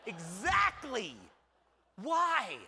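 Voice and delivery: hammed-up exasperated agreement; drawn-out exactly building to a shout; emphatic agreement. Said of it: "And I said".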